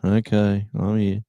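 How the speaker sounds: lightly voiced dog, pleased to have Dad alone; dog voiced by owner. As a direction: speak as the dog settling on his lap. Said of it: "he says".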